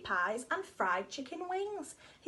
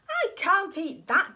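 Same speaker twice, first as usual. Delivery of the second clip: loud disgusted dog; 3 semitones higher, 8 dB louder than usual. Acted. Dog complains about shoe.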